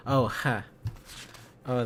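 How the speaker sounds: normal talking voice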